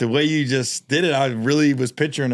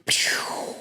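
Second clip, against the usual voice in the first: gunshot noise; 5 dB quieter than usual. Pretend. Gunshot sound in ad bit.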